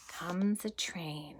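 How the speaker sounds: slow breathy whisper; hushed reveal. Acted. Teacher whispering the train's arrival to children.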